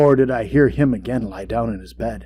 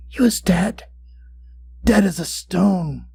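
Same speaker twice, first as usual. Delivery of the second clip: eerie satisfaction; 6 semitones higher than usual. A narrator's reading. Gloating over the corpse.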